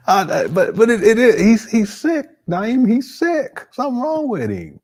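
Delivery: high, loud, stammering outrage; exasperated disbelief. Real, not acted. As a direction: protest in high-pitched, incredulous alarm. Exasperated reaction to a disturbing clip.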